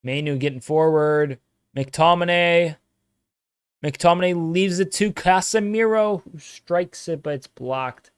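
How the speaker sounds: excited commentator call; play-by-play. Acted. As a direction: call the action as it happens.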